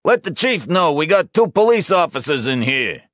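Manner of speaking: loud, high call to the crew; shouted. Acted. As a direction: shout it back over your shoulder. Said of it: "He turned away and shouted".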